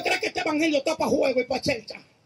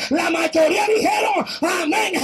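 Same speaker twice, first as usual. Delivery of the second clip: high shouted rebuke; fervent preaching; 6 semitones higher than usual. Acted. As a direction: raise the roof with the correction.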